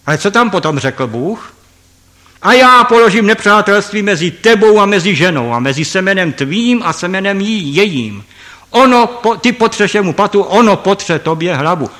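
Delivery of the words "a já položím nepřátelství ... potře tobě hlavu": louder, higher proclamation of God's words; preacher quoting God in Genesis, booming and raised; solemn scripture quote. Acted. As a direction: declaim it like the voice of God.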